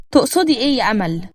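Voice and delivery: ordinary delivery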